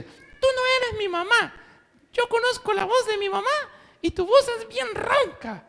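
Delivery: put-on very high, tiny kid voices; little goats scolding. Little goats telling the wolf he is not their mother in tiny high voices. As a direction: squeak it like defiant little goats.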